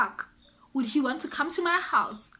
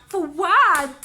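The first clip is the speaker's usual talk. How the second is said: loud, stretched incredulous question; 3 semitones higher, 8 dB louder than usual. Real, not acted. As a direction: burst out as if it makes no sense.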